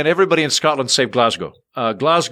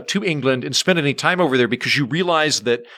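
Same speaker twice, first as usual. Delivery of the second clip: ordinary talk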